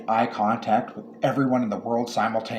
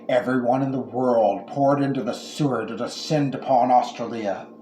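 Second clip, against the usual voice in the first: plain reading voice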